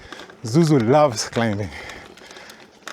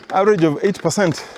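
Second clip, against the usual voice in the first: ordinary delivery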